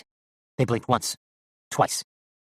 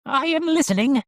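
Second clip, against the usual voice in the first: very high puppet voice; attentive doll; 4 dB louder than usual. A narrator's reading. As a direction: answer your maker in a high doll voice.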